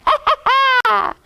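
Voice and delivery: loud, high evil cackle; witch's cackle. Acted. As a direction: cackle in triumph. Witch laughs at her scheme.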